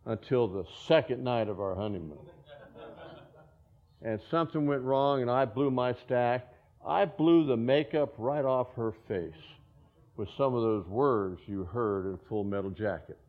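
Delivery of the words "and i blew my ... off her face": ordinary talk, nothing marked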